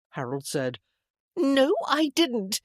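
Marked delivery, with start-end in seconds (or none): 1.4-2.6 very high, loud, drawn-out protest; petulant denial. A narrator's reading. Sister to accusing brother, petulant denial. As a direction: dig in like a squabbling sibling.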